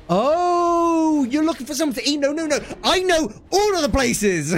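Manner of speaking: high, gushing mock helpfulness; eager know-it-all. Frenchman to someone seeking food, high eager mock reply. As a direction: burst in, thrilled to be asked.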